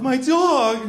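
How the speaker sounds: high mock wail of a little girl; upset little girl. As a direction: whine it like an upset child, hammed up. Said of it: "She's very upset".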